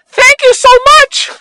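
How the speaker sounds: squeaky falsetto thanks; mock high thank-you. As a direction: flee with a tiny high-pitched thanks. Mock polite reply to scolding lady.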